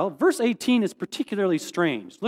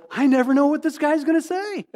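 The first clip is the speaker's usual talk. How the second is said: higher, baffled exasperation; bewildered disciple; 7 semitones higher than usual. Retold or imitated. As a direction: throw up your hands at him. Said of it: "at some point you gotta go".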